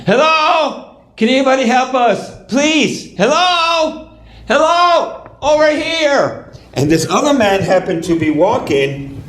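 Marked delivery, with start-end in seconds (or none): 0.1-6.5 high, pleading calls from a pit; acted calling for help. Family who don't wanna be here, high pleading calls. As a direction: call out high for rescue without real volume. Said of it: "the noise going on".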